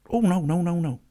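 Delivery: high, loud string of protests; real dismay. Real, not acted. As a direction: blurt it out high and loud in alarm. Reacts to a sudden mishap.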